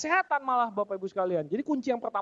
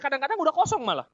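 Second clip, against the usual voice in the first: ordinary delivery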